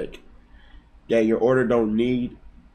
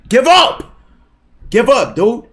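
much higher, louder taunting yell; yelling at chat; 12 semitones higher, 9 dB louder, slower than usual. Real, not acted. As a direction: shout him down, give up. Telling chat viewer to quit.

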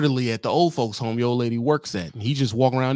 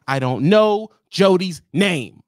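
louder, slower punchline; slowed-down loud payoff; emphatic punchline; 5 semitones higher, 6 dB louder, slower than usual. Acted. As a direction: land it hard and slow.

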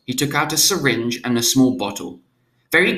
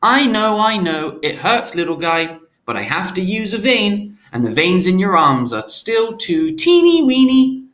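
put-on higher, sing-song soothing baby talk; special baby voice; 8 semitones higher, 4 dB louder than usual. A narrator's reading. Father comforts the hurting newborn, higher baby talk. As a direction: soothe the crying baby like a doting nurturer. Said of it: "Still in the special voice".

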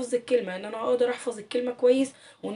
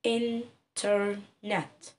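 انترنت said very slowly, split into its three beats; slow, syllabified classroom demo; syllable by syllable; 6 dB quieter, much slower than usual. Shown as a demonstration. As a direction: stretch انترنت out, one chin tap per syllable. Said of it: "وابتدي انطق لي الكلمة".

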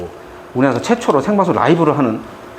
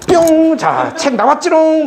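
loud, high, drawn-out mock magic trick; playful magician reveal; 12 semitones higher, 4 dB louder, much slower than usual. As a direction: cover a blunder with silly showmanship. Re-enacting playful on-air cover-up.

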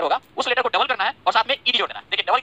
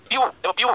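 View said alone as one separate beat; pausing after the syllable. Shown as a demonstration. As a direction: stop after each view to show the break. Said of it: "कितने बार हमको रुकना पड़ता है".

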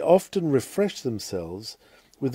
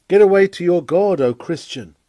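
loud and higher, a preacher's rallying call; urgent exhortation; 5 semitones higher, 7 dB louder than usual. Acted. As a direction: lift it up and out, urging the flock. Preacher exhorting listeners to God.

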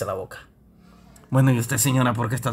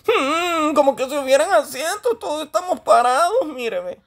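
high, whiny comic character; indignant high-pitched whine, played for laughs; Toñito; 13 semitones higher than usual. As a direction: grumble in a high mock-tearful voice. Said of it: "Eh, Toñito".

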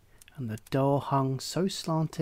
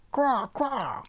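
much higher, louder croaking caw; raven's cry; 10 semitones higher, 4 dB louder than usual. A narrator's reading.

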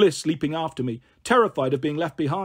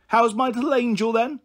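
put-on higher cooing baby talk of an old woman; coochie-coo old lady; 6 semitones higher than usual. Old Kath cooing at little Pixie in baby talk. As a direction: coo at her like a doting granny. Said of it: "made ridiculous coochie-coo noises".

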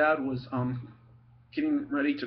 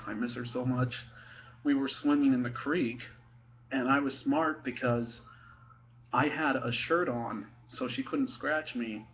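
ordinary talk, nothing marked

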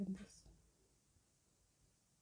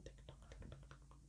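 ticking clock sound; 14 dB quieter than usual. Shown as a demonstration. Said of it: "I'd put like a ticking sound".

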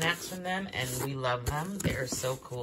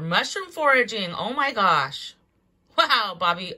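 louder, higher, gushing surprise; delighted amazement; 6 semitones higher, 8 dB louder than usual. Real, not acted. Vlogger finding mushroom tips for Bobby, delighted amazement. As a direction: gush in real amazement at the find.

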